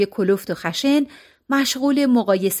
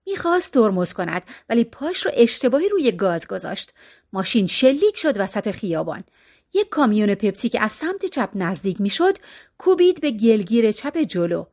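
ordinary delivery

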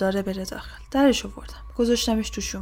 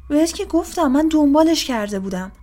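loud, high, insistent retort; exasperated protest; 6 semitones higher, 5 dB louder than usual. A narrator's reading. Woman answers the doubting policeman, exasperated protest. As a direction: insist loudly, fed up with being doubted.

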